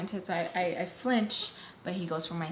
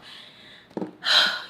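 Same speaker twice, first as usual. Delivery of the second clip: heavy audible sigh; deep breath; 9 dB louder than usual. Retold or imitated. Boyfriend breathing deeply before answering the phone, heavy sigh. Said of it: "He breathes really deeply".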